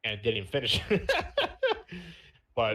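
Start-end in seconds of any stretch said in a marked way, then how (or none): 0.9-2.1 high-pitched burst of laughter. Real, not acted. Laughing at his unfinished story, high laugh.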